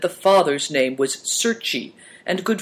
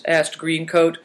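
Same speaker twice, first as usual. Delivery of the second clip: plain reading voice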